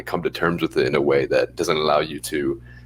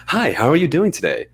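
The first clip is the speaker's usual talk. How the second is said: much higher, bright receptionist greeting; animated front-desk voice; 10 semitones higher, 4 dB louder than usual. Retold or imitated. Dental receptionist greeting patients on the phone, high and animated. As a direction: perk it up like a receptionist answering phones. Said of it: "much more animated and higher pitch".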